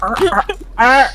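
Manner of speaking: hammed-up high-pitched courtroom voice; squeaky judge voice. Mock courtroom bit continues.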